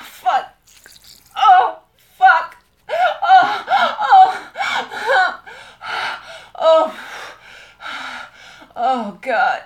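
very loud, high outcry; shouted moans; ecstatic cries. Real, not acted. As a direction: cry out at the peak. Intimate climax.

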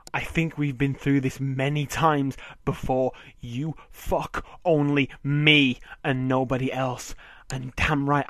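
loud, furious, each word driven home; angry yelling. Acted. Husband yelling at his wife, furious.

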